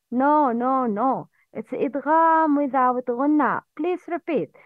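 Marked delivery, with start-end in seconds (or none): none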